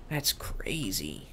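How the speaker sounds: slow hushed whisper. Real, not acted. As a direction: whisper it in awe. Whispering on finding cellar.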